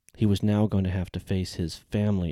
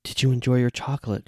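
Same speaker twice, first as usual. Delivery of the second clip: female voice; 6 semitones higher, 4 dB louder than usual. Acted. Janelle asks softly in bed.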